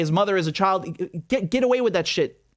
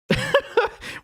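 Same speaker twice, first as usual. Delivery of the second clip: high incredulous laugh. Real, not acted. Laughing at the interview clip.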